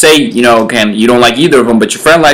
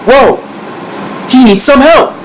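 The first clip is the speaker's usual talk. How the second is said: alarmed friend; 10 semitones higher than usual. Retold or imitated. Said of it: "he's like".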